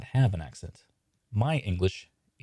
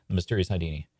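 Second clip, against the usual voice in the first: ordinary talk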